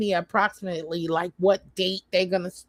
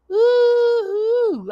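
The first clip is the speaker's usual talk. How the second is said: excited ooh; 6 dB louder than usual. Real, not acted. Remembers her list excitedly.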